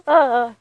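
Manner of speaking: loud burst of exasperation; frustrated cry. Real, not acted. Frustrated at first-person smut.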